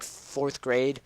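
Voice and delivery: ordinary delivery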